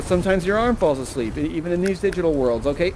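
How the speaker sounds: usual speaking voice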